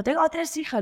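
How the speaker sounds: high, indignant mock retort of a policeman; imitating the cop. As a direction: snap back as the offended officer. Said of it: "el tío me gritaba".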